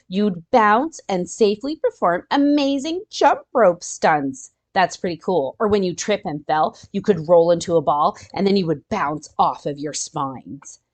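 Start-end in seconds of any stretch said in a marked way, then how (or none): none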